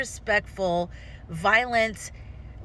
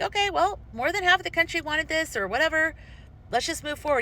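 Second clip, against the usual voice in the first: high, breezy mock resignation; resigned acceptance; 9 semitones higher than usual. Voicing a voter accepting the loss, high and breezy. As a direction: shrug it off and move on lightly. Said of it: "You're like".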